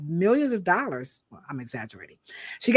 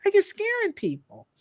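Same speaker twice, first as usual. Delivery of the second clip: high-pitched indignant disbelief, fast at the start; incredulous outrage; 10 semitones higher, faster than usual. Real, not acted. Ranting at fear-mongering hair experts, high and incredulous. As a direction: voice shoots up, what the hell are you doing. Indignant rant about scaring people.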